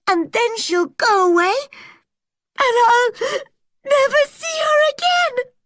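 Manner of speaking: very high, loud tearful wail; sobbing. A narrator's reading. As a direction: cry it out, breaking on the last words. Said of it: "sobbed Anne".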